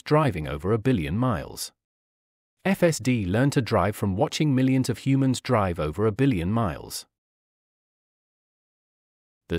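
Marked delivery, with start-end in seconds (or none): none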